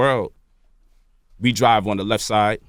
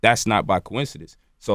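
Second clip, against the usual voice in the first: usual speaking voice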